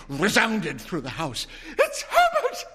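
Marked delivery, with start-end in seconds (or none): 1.8-2.6 very high, loud scream of joy. Acted. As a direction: shriek the name in wild hope. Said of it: "she screamed".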